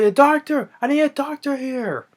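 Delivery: high-pitched call for help; mock urgent call. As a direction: call out in hammed-up panic. Acts out calling for doctor.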